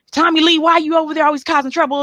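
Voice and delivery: scolding Southern parent. Retold or imitated. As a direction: chide the kid like a country mom. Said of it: "It was no".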